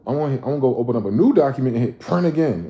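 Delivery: mock reckless self-talk; exasperated inner voice. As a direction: blurt it like you've given up caring. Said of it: "your ass just said".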